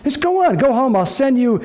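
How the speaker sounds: high, breezy coaxing as David; mock coaxing king. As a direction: sweet-talk Uriah out the door. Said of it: "This is his plan".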